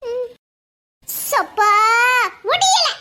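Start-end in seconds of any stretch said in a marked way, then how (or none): 1.3-2.9 loud groan rising to a shout; exasperated cry. Acted. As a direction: burst out at the end of your patience. Exasperated cry of can't take it.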